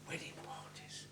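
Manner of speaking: breathy secret whisper. Acted. Phrase repeated, second time whispered.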